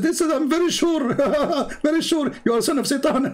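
shrill high mimicry of certainty; imitating Abdul. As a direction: insist like the cocky caller. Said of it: "He said".

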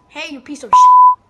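shrieked insult, very high and loud; hailing a taxi. Pretend. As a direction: shriek it at the top of your lungs. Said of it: "Or how about this?".

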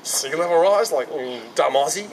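lightly imitated South African accent; mock South African accent, a touch put-on; broad South African accent. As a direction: mimic a South African scoffing. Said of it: "like, very broad South African accent".

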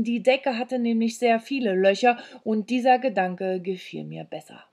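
ordinary delivery, nothing marked

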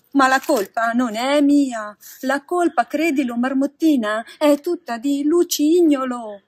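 wailing blame onto someone else; plaintive protest. A narrator's reading. Pinocchio protests, blaming his friend.

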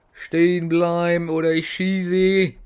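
high, loud, slow shouted command; mock police shout. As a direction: bark it like a cop in a film. Mock police warning to enemy. (gespielter Polizeibefehl, laut und hoch gebrüllt)

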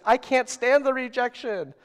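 high, loud dramatic protest; hammed-up fear of rejection; mock anguish. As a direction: wail it like an overblown excuse. Acts out rejection fears.

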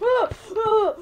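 high, very loud startled shrieks; frightened screams. Real, not acted. Monster scare in game.

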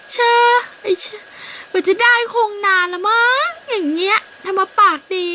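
high, loud sneering retort; mocking scoff. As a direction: sneer back at the rival, scornful. Birds quarrel, mocking retort. (เสียงสูงดังเยาะเย้ยคู่กรณี)

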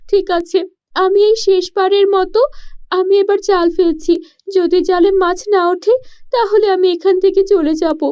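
put-on a poor village girl puzzling aloud; young girl voice.

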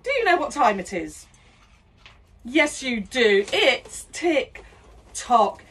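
ordinary delivery

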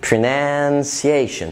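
"pronunciation" very slow, lingering before "see-ay-shun"; "pronunciation" stretched, c said as a clear s; sia sound, exaggerated. Shown as a demonstration. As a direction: drag out "pronunciation" to show the s. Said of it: "بيتنطق سيا".

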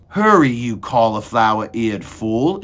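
loud, scolding order; impatient shout. A narrator's reading. Cook hurries Meg to kill the mouse, shouting. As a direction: shout it as an insult to hurry someone. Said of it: "shouted Cook".

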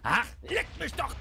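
angry shout. Real, not acted. Rage after being killed.

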